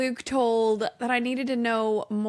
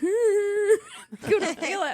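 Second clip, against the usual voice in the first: put-on high, tearful kid protest; kid's voice; 8 semitones higher, much slower than usual. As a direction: whine it like a betrayed child. Kids' reply, voiced high.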